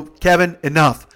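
loud and firm, falling at the end; retold stern command. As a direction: cut him off with authority. Teller cuts Kevin off, retold.

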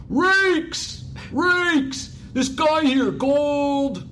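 very high, loud yell down the hall; Ballmer screaming. Retold or imitated. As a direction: holler the name across the office. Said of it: "he screams down the hall".

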